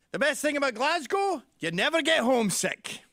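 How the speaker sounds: loud, high Glaswegian dad impression; imitating dad. Dad holding forth about Glasgow, loudly imitated. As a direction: belt it out as the Glasgow father. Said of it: "He goes".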